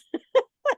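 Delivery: a high loud burst of laughter; laughing. Real, not acted. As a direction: laugh out at the absurdity. Speaker laughs at own point.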